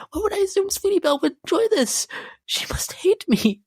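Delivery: high, fast whisper; whispered remorse. Acted. Seamstress whispering her shame to her audience, remorseful. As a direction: whisper it in a guilty rush.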